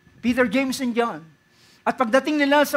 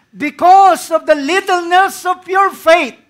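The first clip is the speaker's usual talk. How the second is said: loud, high and slow; preacher proclaiming Jesus to the disciples; booming proclamation; 8 semitones higher, 13 dB louder, slower than usual. Acted. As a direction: lay the rebuke down heavily.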